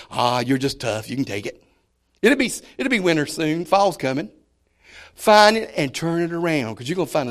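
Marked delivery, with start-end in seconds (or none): 0.1-1.6 gently buck them up; encouraging. Shown as a demonstration. Preacher shows how to encourage a friend in the heat, softly. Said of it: "Just say".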